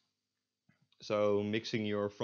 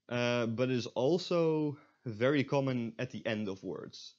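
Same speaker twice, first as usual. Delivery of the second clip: normal talking voice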